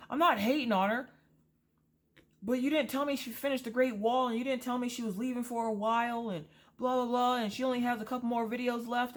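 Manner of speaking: high, fast whiny mock of the husband. Wife mimicking husband complaining about the YouTuber, high mocking whine. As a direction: squeak out his rambling complaint to make fun of it.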